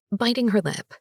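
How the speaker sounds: plain reading voice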